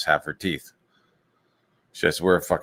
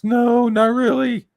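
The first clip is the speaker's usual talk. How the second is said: loud, high, drawn-out falsetto; mocking caricature, pitched way up; Nicholas; 6 dB louder, slower than usual. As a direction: answer slowly in a silly high voice. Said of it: "he sounds like that".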